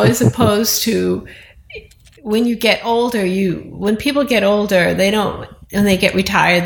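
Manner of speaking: normal talking voice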